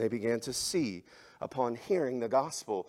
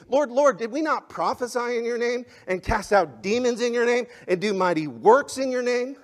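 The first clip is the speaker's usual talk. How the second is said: high, loud preaching that builds to each point; fiery preaching; 6 semitones higher, 8 dB louder than usual. Acted. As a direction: drive it home from the pulpit like a revival preacher. Preaching Matthew seven aloud.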